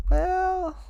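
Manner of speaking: high, loud, stretched-out hedge; drawn-out hesitant well. Retold or imitated. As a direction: stretch it up high, breaking bad news. Director hesitantly breaking bad news.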